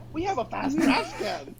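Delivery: laughing. Pretend. Laughing mock news.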